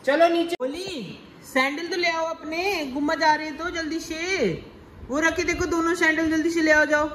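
high sing-song coaxing of toddlers. Real, not acted. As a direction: hurry the little ones along, high and playful. Father coaxing kids to get sandals. (बच्चों को ऊँची आवाज़ में मनाना)

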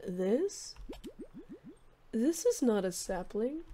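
soft, halting character voice; gentle, hesitant Cherub. Acted. Cherub accepting a ghost lily, soft halting voice. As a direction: speak softly with long pauses, humbly grateful.

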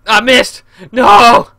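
loud, high cry of dismay; shouty voice. Real, not acted. Gamer misses the target, dismayed shout. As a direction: yell out in frustration. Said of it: "my shouty voice".

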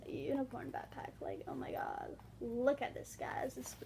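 breathless whispered gushing; hushed thrill over a new backpack; excited whisper. Real, not acted. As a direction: whisper it like a treasured secret.

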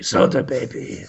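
breathy announcer whisper of the title. Acted. Whispering the show title like a trailer voice.

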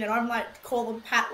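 usual speaking voice

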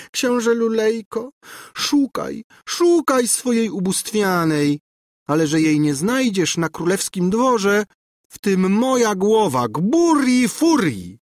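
high, gloating threat; screeching taunt. A narrator's reading. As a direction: taunt in a screech, stretching the final name. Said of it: "skrzeczała za nim Gburja Furia".

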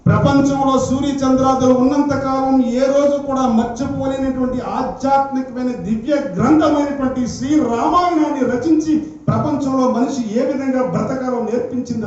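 high, sustained declamation; fervent preaching. Real, not acted. Preacher extolling the holy Ramayana to devotees, fervent preaching. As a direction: proclaim it in one long sweeping breath.

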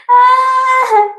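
long high-pitched "aah"; high squeal. Real, not acted. Laughing at a viewer's comment, high squealed "ah". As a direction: squeal out a sustained "ah" after laughing.

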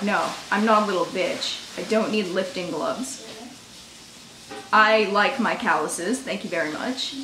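low, quiet, serious correction; deadpan insistence. Retold or imitated. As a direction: restate it dead serious, dropped low. Not joking after date laughed.

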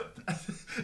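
breathy suppressed laugh burst; stifled giggle. Real, not acted. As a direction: laugh escaping through the nose. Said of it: "ich konnte mich nicht mehr zurückhalten". (unterdrücktes Kichern)

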